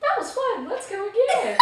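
high, bright-eyed put-on girl voice; lightly hammed-up giddy girl; imitating friend. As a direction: mimic the friend's eager voice after the ride. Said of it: "she's like".

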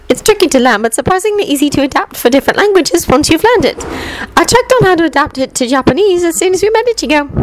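put-on high, eager know-it-all girl lecturing; Hermione's voice. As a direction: explain it proudly like the top student.

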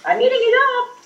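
rising to a very high held pitch; playful squeal. Real, not acted. Player celebrating eating it up, in a high playful squeal. Playful sing-out while gaming.

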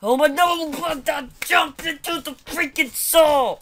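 loud, high frustrated shout; full-on angry yelling; real gamer rage. Real, not acted. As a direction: scream in disbelief at dying. Player dies on the saw.

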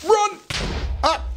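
sudden high-pitched alarm; urgent shout. Pretend. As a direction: yell it to flee at once. Calling out during game danger.

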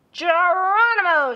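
high, loud, slow battle cry; joyful shout. Acted. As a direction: yell it launching off the ramp. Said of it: "shouted Sister".

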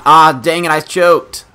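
high, loud cry of frustration; frustrated outburst. Real, not acted. Player who just choked, loudly frustrated. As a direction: yell at your own blunder.

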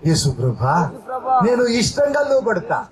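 intense pleading prayer, rising on each plea; fervent prayer. Acted. Preacher prays over the congregation, fervent pleading prayer. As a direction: pour it out to Jesus, pleading.

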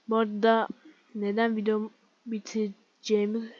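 usual speaking voice

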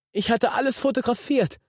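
put-on high, tearful boy's voice; almost crying it out; near tears. A narrator's reading. Guilty boy confessing, near tears. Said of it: "Martin heulte fast".

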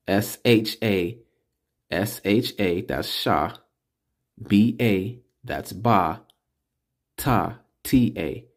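S H A, B A, T A spelled letter by letter, slowly; patient teacher spelling each letter. Shown as a demonstration. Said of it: "spelling it out".

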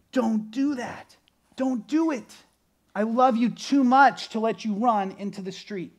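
put-on a dad yanking his kid back from the road; urgent dad warning.

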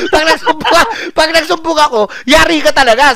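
high, loud hammed-up warning; mock threat. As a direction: shout the joking threat. Repeats threat to driver louder.

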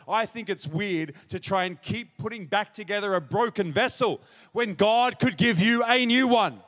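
gather force and ring out at the end; impassioned preaching. Real, not acted. Preacher contrasts broken lives with God's gift, building impassioned preaching.

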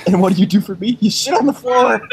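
breaking into laughter mid-rant; laughing mock complaint. Husband griping at wife, laughing through it.

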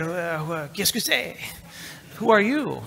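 a confused French Canadian support clerk; mock French accent. Support clerk puzzled by an unknown caller, mock French accent. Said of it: "now they have a French accent".

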